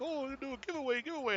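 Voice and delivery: high, fast mock of eager streamers; sing-song parody of streamers begging for subs; mocking hype voice. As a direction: squeal it like a cheesy giveaway hype. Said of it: "so many people are like".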